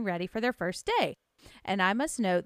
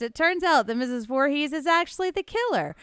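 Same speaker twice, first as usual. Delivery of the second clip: ordinary delivery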